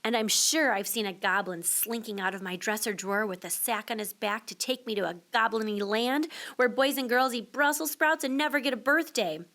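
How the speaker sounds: usual speaking voice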